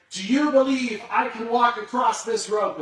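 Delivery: loud, high call across the falls; yelling to the crowd. Retold or imitated. Tightrope walker shouting to the crowd below, loud and high. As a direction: shout it so thousands can hear. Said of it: "he would yell so that as many people".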